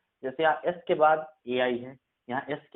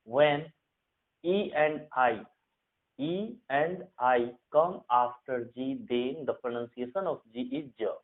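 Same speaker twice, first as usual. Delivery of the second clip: slow, deliberate rule dictation; much slower than usual. Shown as a demonstration. Teacher to Hindi students, dictating the rule slowly. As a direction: spell out the rule word by word for students.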